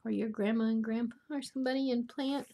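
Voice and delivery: ordinary delivery, nothing marked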